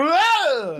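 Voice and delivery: high yelp as he falls; startled yelp. Acted. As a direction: yelp while tripping. Hobgoblin falls prone mid-sentence.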